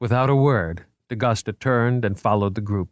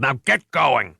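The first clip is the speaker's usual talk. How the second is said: urgent shout; 5 semitones higher, 3 dB louder, slower than usual. Acted. Cop ordering people away.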